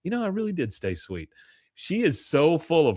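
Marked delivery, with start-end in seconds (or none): 0.0-1.3 high, soft self-satisfied quote; Peggy. Retold or imitated. Peggy admiring herself over her yearbook, soft high voice. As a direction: muse it softly, pitched up. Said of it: "looking at it and goes".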